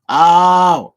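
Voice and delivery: loud, high "oh" of dawning; a sudden penny-drop exclamation; loud aha moment; sudden realisation. Real, not acted. Realises the right pronunciation.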